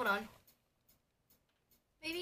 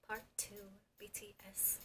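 hushed reading; 3 semitones lower, 17 dB quieter, faster than usual. Real, not acted. Reads album title hushed.

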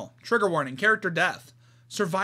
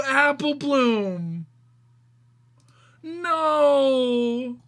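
slow, crying dismay at the death; real distress; 4 semitones higher, much slower than usual. Real, not acted. Reader mourning Applebloom, slow, crying. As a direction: let the grief break through, slow.

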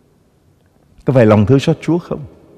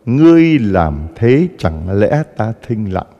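slow solemn reading; slower than usual. Shown as a demonstration. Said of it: "Nghe rõ chưa?".

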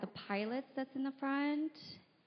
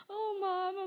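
high mock whine to mom; whiny; 8 semitones higher, 4 dB louder than usual. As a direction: act out a whiny, upset daughter. Acts out her upset self to mom.

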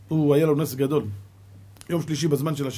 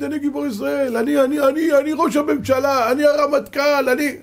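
much higher, loud puffed-up bragging; mocking boasting; 12 semitones higher, 6 dB louder than usual. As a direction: parade the titles like a windbag. Mocking boasts at heaven's gate. (התרברבות לועגת בקול גבוה ורם)

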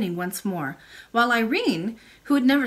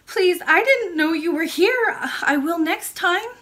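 put-on high, louder little-girl voice; earnest child princess; 9 semitones higher, 5 dB louder than usual. A narrator's reading. Princess promises the old lady, in an earnest child's voice. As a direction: plead a little as a young princess.

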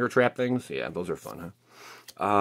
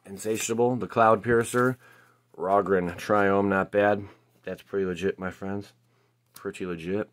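ordinary talk

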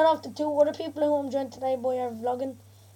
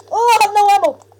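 alarmed outburst; 5 semitones higher, 9 dB louder than usual. Real, not acted. Runs out of ammo mid-game.

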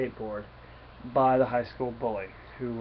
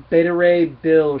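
loud, slow heralded entrance; dramatic announcement; 4 semitones higher, 13 dB louder, slower than usual. Acted. Storyteller reveals who has shown up, dramatic announcement. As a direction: announce the big arrival, stretched and loud.

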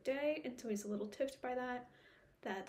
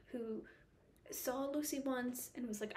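ordinary delivery

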